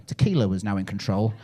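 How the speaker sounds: ordinary talk, nothing marked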